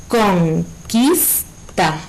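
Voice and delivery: conquista stretched slowly into con-quis-ta; syllable by syllable. Shown as a demonstration. Teacher slowly splitting conquista into syllables. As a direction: spell out conquista by syllables, very slow. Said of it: "Según las sílabas que tienen las palabras".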